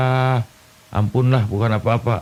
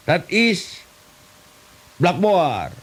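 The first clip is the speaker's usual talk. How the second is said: much higher, slow mock English; broken English; 7 semitones higher, much slower than usual. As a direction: ham up clumsy English. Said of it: "Bahasa Inggris tapi kepakojot". (bahasa Inggris ngawur, nada tinggi dibuat-buat)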